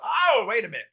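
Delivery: mock alarm. Trainer halting a student who says three syllables, mock-alarmed interruption. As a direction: stop them in their tracks, pitched high. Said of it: "I'm like".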